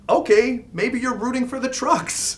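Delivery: high incredulous exasperation; exasperated. Real, not acted. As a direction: voice jumps up in disbelief. Mock exasperated realization.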